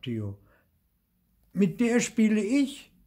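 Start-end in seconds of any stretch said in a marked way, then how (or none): 1.5-2.9 put-on high, insistent child's claim; raised-voice kid objecting; indignant protest. A narrator's reading. Theo protests at snatched shovel.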